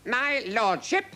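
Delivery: slow, high incredulous question; affronted king. A narrator's reading. King taking offence at the captain's title, affronted. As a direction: query it with royal offence.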